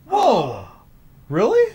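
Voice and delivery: a host hears the goaltender twist, amazed; surprised. Real, not acted. Reacting to surprising story.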